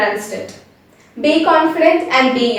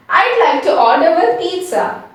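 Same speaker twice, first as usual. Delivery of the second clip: ordinary delivery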